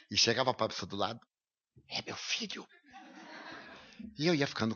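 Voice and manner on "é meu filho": whispered proud aside to a neighbour; a father whispering to the man beside him, proud. Retold or imitated. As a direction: lean over and whisper it, quietly proud.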